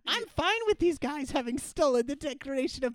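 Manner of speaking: high, mock-indignant rush of words; film heroine voice. Heroine accepting the thieves, high mock indignation. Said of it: "she's like".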